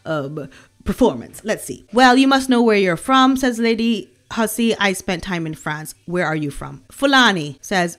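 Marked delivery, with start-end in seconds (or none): none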